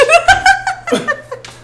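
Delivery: high, loud burst of laughter; laughing. Real, not acted. Host cracking up after saying the co-host has left, real high laughter. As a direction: crack up helplessly, high-pitched.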